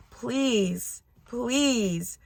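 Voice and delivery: slow, imploring retold plea; drawn-out, heartfelt entreaty; begging. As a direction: beg earnestly, stretching each please. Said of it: "Like, she's begging me".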